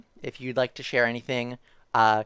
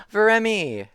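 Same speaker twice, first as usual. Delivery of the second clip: gasp, then the name up high and louder; surprised gasp; 9 semitones higher, 7 dB louder than usual. Real, not acted. Streamer startled by a viewer's cheer, gasping the name. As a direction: caught off guard by a cheer. Chat cheer interrupts the reading.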